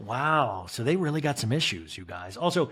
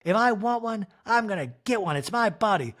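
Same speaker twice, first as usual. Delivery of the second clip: higher, pushy, stubborn wife; mock high defiant wife; 7 semitones higher than usual. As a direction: insist on her own body, voice pitched up. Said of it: "Kyle's like".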